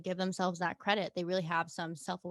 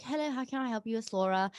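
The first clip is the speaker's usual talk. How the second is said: higher-pitched British phone greeting; British accent; 4 semitones higher than usual. Retold or imitated. Guest acts answering the work phone, British accent. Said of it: "and I turned it on".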